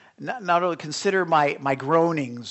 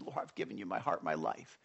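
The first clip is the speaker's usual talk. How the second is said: hushed prayer; 9 semitones lower, 13 dB quieter than usual. Retold or imitated. Quiet prayer acted.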